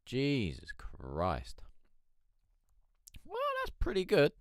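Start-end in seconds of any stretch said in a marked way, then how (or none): 3.2-4.3 high squeaky voice. Real, not acted. Impressed at 1608 pieces.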